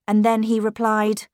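plain reading voice

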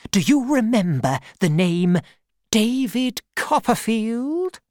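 aunt quizzing Mister Dick; aunt asking. Acted. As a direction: probe his memory. Aunt voiced questioning.

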